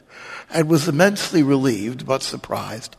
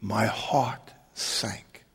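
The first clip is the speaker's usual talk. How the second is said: ordinary talk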